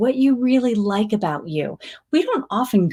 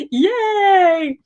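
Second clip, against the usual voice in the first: cheering yay; 10 semitones higher than usual. Real, not acted. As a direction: cheer it out like a fan. Cheering a viewer.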